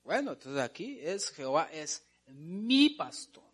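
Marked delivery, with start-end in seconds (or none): 1.3-3.4 long, rising swell on "mi"; énfasis en mi. Shown as a demonstration. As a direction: hold "mi" to claim it as one's own. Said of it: "mío". ("mi" largo y recalcado)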